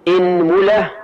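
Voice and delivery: إنملة with i then mu, inmula; إنملة, short i then rounded mu; inmula with damma on mim. Shown as a demonstration. Teacher lists the next variant for the class, inmula. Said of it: "فنقول".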